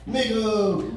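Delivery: high, excited cry of arrival; Korean arrival exclaiming. Retold or imitated. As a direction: shout it like you've just landed in America. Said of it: "they'd step off the airplane and go".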